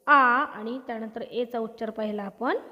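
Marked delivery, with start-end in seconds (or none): 0.1-0.1 आ as a long open "aa"; vowel demo. Shown as a demonstration. Teacher recaps the sound of A, long आ vowel demo. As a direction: sound out a held आ by itself.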